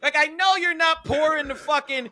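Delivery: high, loud comic tirade; outraged disbelief at full volume; exasperated rant. Real, not acted. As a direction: build into an incredulous, high-pitched rant. Climactic rant about nameless cats.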